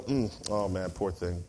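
low, soft, sighing sympathy; soft mocking churchy sympathy; mock pious pity. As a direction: imitate a churchgoer's hollow pity. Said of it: "they go".

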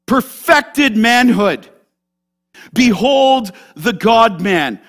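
loud, slow, solemn declaration; preaching proclamation. Acted. Preacher proclaiming Jesus to his church, loud and slow. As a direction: proclaim it to the congregation. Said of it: "preacher's own proclamation".

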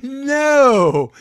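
a loud, high, emphatic no; firm shout. Real, not acted. Answering the imagined critics, a loud emphatic no. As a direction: shut the idea down hard. Firm refusal after mock taunt.

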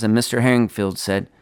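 ordinary delivery

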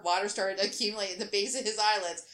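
ordinary delivery, nothing marked

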